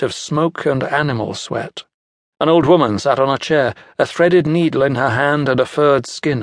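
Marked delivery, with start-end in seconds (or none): none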